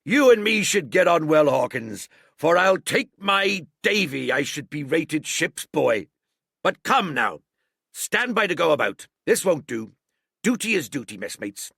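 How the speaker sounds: loud, high jovial pirate; rally the crew cheerfully; hearty old sea cook. A narrator's reading. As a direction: landlord heartily ordering his messmates. Silver hearty to Jim.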